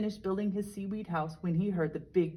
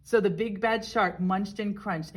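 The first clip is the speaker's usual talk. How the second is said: ordinary delivery, nothing marked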